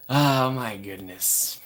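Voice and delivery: very slow stretched oh my goodness; slow amazed sigh; drawn-out gasp. Acted. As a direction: draw it out in awe.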